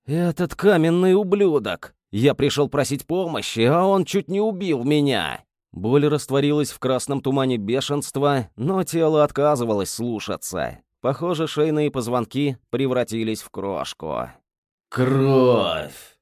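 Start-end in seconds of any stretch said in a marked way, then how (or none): 0.1-5.4 enraged. Acted. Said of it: "Ярость вскипела в венах". 14.9-16.2 rasped through gritted teeth; hoarse wheeze. Acted. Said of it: "прохрипел сквозь стиснутые зубы".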